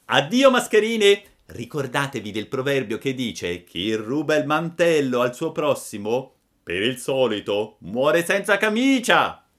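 a cheeky boy mocking two swindlers; scornful puppet. A narrator's reading. Pinocchio's final scornful proverb.

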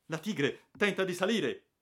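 high, loud alarm cry; panicked shout. Acted. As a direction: scream the warning that it climbs. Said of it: "urlò un daiaco".

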